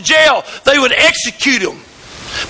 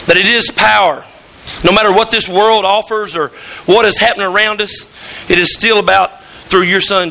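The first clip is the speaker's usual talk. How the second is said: reverent spoken prayer, slightly lower; 5 semitones lower than usual. Acted. Pastor praying over the church in a reverent prayer voice. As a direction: pray over the congregation, steady and earnest. Leads opening prayer before sermon.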